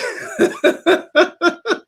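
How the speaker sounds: high burst of laughter; laughing. Real, not acted. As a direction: laugh out at the punchline. Laughing at the story.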